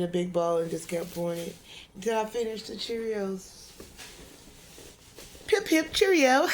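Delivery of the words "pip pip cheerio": high, slow, plummy British "Pip, pip, Cheerio"; jokey upper-class British voice; mock British accent. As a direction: toff it up like an English gent. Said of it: "some type of accent".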